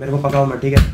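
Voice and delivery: loud, sharp brush-off; mock angry snap. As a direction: shut her down, pretending annoyance. Faked quarrel.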